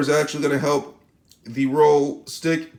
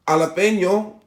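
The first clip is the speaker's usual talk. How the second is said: higher-pitched Spanish-style jalapeno; Spanish-accented jalapeno, pitched up; 4 semitones higher than usual. Shown as a demonstration. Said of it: "Sorry for my Spanish accent".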